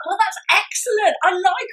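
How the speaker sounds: bright, high burst of delight; excited praise. Real, not acted. Host delighting in the guest's idea, excited. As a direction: gush with real enthusiasm.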